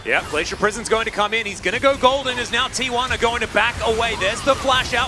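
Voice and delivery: high, loud, rapid-fire hype call; hype play-by-play. Real, not acted. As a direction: yell the teamfight like a caster. Caster calling a big teamfight.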